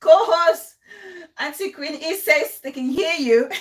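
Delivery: loud, laughing call-out. Real, not acted. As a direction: laugh through the shout. Laughing while calling co-host.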